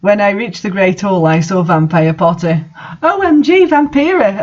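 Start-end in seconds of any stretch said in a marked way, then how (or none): 3.0-4.4 high squealing greeting, much higher; excited yell. A narrator's reading. Raven spotting her vampire friend, a high excited cry. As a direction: shriek her name in delight. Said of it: "I yelled".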